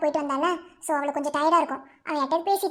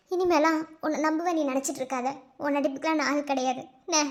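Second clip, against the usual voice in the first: ordinary delivery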